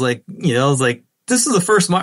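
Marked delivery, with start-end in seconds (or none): 1.3-2.0 pumped up. Retold or imitated. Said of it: "I'm so pumped".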